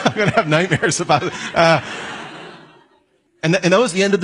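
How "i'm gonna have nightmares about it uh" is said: laughing. Real, not acted. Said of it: "That's really sexy".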